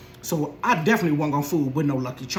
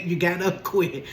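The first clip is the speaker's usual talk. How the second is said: normal talking voice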